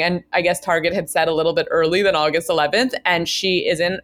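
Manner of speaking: ordinary talk, nothing marked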